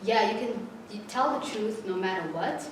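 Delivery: ordinary talk, nothing marked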